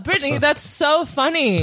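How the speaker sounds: usual speaking voice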